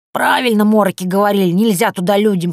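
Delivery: put-on old witch warning them off.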